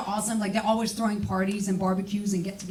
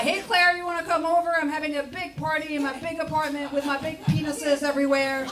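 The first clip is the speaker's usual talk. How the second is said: bragging friend; 7 semitones higher than usual. Retold or imitated. Friend inviting Claire to her party, high and bragging. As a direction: brag like a show-off host.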